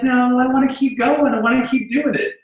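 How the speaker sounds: wife insisting. Retold or imitated. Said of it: "She's like".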